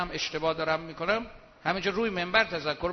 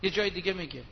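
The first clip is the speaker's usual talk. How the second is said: ordinary talk, nothing marked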